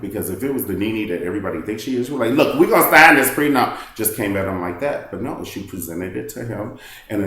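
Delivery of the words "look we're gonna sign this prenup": loud, higher, forceful declaration; mock blunt demand. Imagined Nene bluntly telling Greg to sign, loudly. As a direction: slam the demand down.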